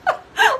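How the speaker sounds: high, loud burst of real laughter; a sudden loud laugh. Real, not acted. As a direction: break into a laugh at yourself. Laughing at her own story.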